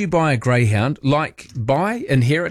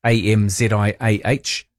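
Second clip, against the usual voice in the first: ordinary talk, nothing marked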